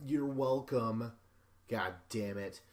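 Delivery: a hammed-up TV producer talking down; mock producer voice. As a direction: drawl it out like a smug producer. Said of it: "the producer's like".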